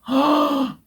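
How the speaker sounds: high, sharp intake of breath; shocked gasp. Retold or imitated. Patient hearing her bladder could burst, shocked gasp. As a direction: gasp "oh" high in mock horror.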